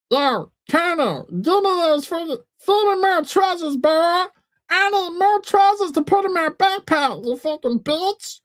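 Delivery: very high put-on cartoon voice; Meatwad. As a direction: squeal out demands as a cartoon creature. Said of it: "It's just fucking Meatwad from Advent".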